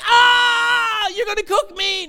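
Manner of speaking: high, loud hammed-up panic scream; freaking out like Piglet. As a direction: shriek it in mock terror, overdramatic. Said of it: "you get like Piglet".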